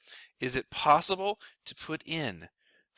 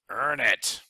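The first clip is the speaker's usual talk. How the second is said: punchy louder delivery; dramatic punchline; 5 dB louder than usual. Acted. Financial planner punching his point to viewers, dramatic. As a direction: land it like a slogan.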